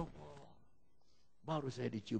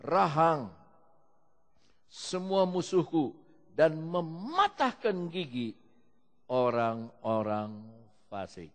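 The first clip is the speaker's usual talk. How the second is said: slow, louder proclaiming of the psalm; preached scripture; 4 semitones higher, 9 dB louder, slower than usual. Acted. Preacher proclaiming the last verse to the church, slow loud declaiming. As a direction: declaim each word like a battle cry.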